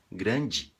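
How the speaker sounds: "Grande" ending "ndji"; wrong grandji. Shown as a demonstration. Teacher warning about the Brazilian error. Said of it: "mudando duas letras".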